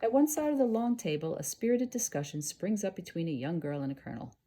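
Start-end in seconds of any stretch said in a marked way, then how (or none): none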